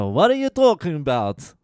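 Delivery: put-on loud, high stab at a Greek accent; attempted Greek accent. Old Greek man protesting in the queue, attempted Greek accent. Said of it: "I'm not even gonna fucking try".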